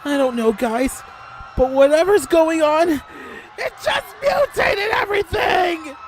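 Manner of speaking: high, loud yell building to a slow wail; panicked shouting. Acted. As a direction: cry out in alarm, stretching the last words. Panicked report as monsters appear.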